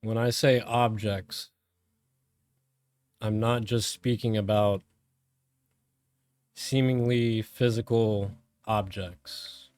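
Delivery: calm, measured meditation voice with long pauses; guided meditation. Real, not acted. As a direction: guide them inward, phrase by phrase, letting each one settle.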